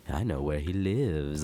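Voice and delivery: slow, low stalker voice; mock stalker. Teasing comic about his cougar fan, mock stalker. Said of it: "stalking you and be like".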